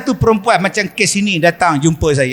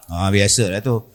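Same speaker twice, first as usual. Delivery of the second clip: dismissive husband; 12 semitones lower, 5 dB quieter than usual. Acted. Husband voiced in story.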